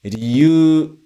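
"Ryu" said ri-yu-u, sounds separate; teacher models "Ryu" with syllables apart; uncontracted riyuu. Shown as a demonstration. Teacher showing reason word: "Ryu" as ri-yu-u. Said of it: "Primeiro, o motivo".

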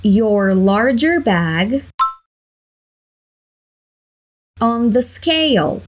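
"your larger bag on the scale" slowed right down; slow drill. Shown as a demonstration. Teacher drilling the attendant's line, slow. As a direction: stretch the phrase slowly and clearly. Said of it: "Please put".

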